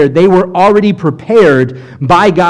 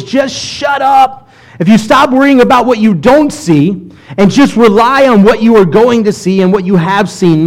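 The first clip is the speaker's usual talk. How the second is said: Moses shouting; 6 semitones higher than usual. Acted. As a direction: bellow it at the grumbling crowd. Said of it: "what Moses is in essence saying".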